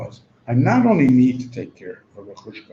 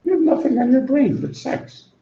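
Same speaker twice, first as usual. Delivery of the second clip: retorting Gingrich; 8 semitones higher than usual. Retold or imitated. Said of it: "he says".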